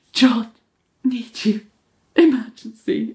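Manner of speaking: broken into short pieces with pauses; restrained mock urgency, word by word; halting urgent plea. [name] interrupts John at the elevator, halting restrained urgency.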